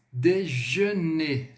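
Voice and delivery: "Déjeuner" very slowly, syllable by syllable; careful "je" sound. Shown as a demonstration. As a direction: stretch out the "je" in "Déjeuner". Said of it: "esse je, bem parecido quando".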